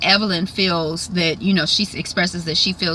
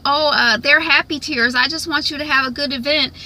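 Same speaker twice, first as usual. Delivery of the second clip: higher, mock-sweet cover story; fake-cheerful excuse, imitated; 7 semitones higher than usual. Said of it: "Jackie is like".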